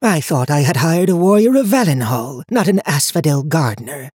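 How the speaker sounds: put-on high, cold voice of an old seer; stern old woman. A narrator's reading. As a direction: speak down to him like a haughty elder. High Watcher voiced in story.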